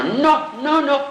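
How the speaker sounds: high-pitched imitated protest; alarmed refusal. Acts out actress's alarmed refusal. (recusa aflita imitada, bem aguda)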